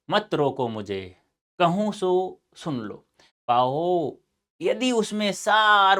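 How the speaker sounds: slow, drawn-out dramatic verse reading; poem recitation. Acted. Teacher voicing Kaikeyi's plea to be heard, slow recitation. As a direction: declaim it slowly, stretching the words.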